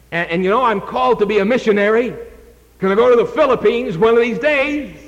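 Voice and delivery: high, eager rambling excuses. Retold or imitated. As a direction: talk yourself into waiting, pitched high. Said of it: "Doug said".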